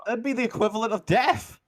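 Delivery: high-pitched protesting guard; in-character squeaky indignation; indignant guard. Acted. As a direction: argue back as a flustered little underling. Guard rejects the offer.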